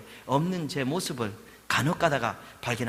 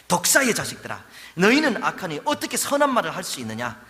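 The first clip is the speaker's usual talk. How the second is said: stern rebuke; 4 semitones higher, 6 dB louder than usual. Acted. Preacher voicing Jesus rebuking.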